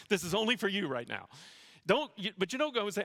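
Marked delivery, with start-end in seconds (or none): none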